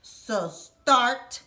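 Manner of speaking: put-on lively, expressive read-aloud for kids; storybook reading. A narrator's reading. Host reading a picture book to children, animated storytelling. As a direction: tell it like a bedtime picture book, big and animated.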